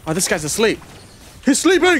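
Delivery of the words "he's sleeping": high, loud excited yelp; excited shout. Real, not acted. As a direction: blurt it out in excitement. Excited reaction during gameplay.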